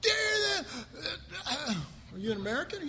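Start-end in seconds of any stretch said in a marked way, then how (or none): 0.0-1.8 mock outrage. Pastor mimicking offended churchgoers, hammed-up outrage. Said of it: "most of us go".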